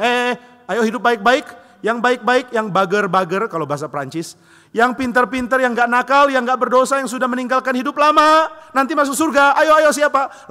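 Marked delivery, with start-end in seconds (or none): 0.0-3.5 high, loud, slow sing-song coaxing; mock coaxing. A false God coaxing people with heaven, high sing-song. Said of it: "Tuhan pernah bilang". 4.7-10.5 mock coaxing. A false God coaxing people with heaven, high sing-song. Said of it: "Tuhan pernah bilang".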